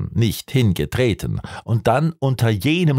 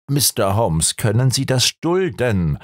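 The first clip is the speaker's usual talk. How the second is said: usual speaking voice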